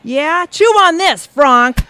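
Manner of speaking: yelled taunt while throwing; shout. Acted. Coworker shouting a taunt while hurling a bagel. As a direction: bark it out as you hurl the bagel.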